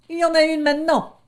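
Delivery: high, fast cheeky lamb; quick high lamb comeback; pert lamb retort. Acted. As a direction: snap the fact back quickly. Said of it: "appuya l'agneau".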